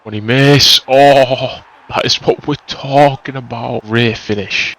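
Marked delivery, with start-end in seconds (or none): none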